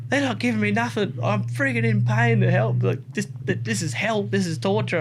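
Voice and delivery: high, anguished moaning of an injured mate; pained complaint. Retold or imitated. Injured Luciano telling Toby nobody helps him, anguished complaint. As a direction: whine that this is torture. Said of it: "he goes".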